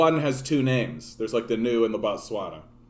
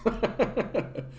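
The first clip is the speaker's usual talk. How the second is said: a real high laugh; amused laugh at his own imitation; 10 semitones higher, 4 dB quieter than usual. Real, not acted.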